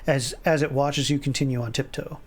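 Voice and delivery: ordinary delivery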